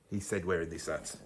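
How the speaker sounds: very soft, low self-mocking aside; muttered aside. Real, not acted. Undercutting his own rant about taking it, muttered aside. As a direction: drop right down, almost under your breath.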